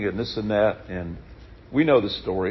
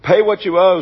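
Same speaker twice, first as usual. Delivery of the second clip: demanding payment; 5 semitones higher, 5 dB louder than usual. Acted. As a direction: lean on him for the debt. Servant demanding payment again.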